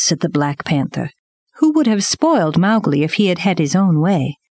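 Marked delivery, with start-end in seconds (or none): none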